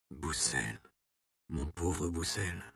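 hushed, low, slow murmur; whispered. A narrator's reading. Count holding the dying abbot's arm, hushed pitying whisper. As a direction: whisper it slowly with pity. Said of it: "chuchota le comte".

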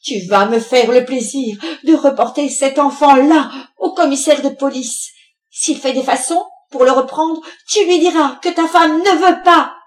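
loud, high, angry command; irate ultimatum building to a shout; threatening order. A narrator's reading. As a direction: lay down the law to your husband. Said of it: "avec un geste menaçant".

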